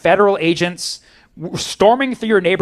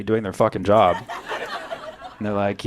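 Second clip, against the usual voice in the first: usual speaking voice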